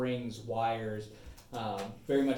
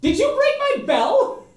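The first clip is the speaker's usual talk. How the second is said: indignant high shout; 11 dB louder than usual. Acted. Gnome scolds bell breaker.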